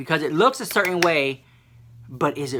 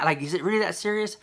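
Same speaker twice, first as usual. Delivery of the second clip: normal talking voice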